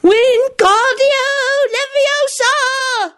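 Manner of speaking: put-on very high, drawn-out spell in a kid's voice; determined child's shout. A narrator's reading. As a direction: chant it slowly and insistently as a small child. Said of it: "shouted Teddy determinedly".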